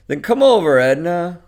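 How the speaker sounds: loud, high, pushy invitation; insistent urging. Acted. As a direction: press her hard to give in. Joe pressures Edna to come.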